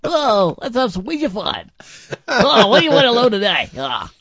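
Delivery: high, wacky cartoon host voice; hammed-up zany game-show spirit; crazy Ouija guy. Co-host playing a fun Ouija board to dad, high zany voice. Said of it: "I'm the crazy Ouija guy".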